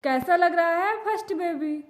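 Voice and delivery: ordinary talk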